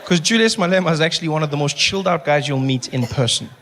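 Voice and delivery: usual speaking voice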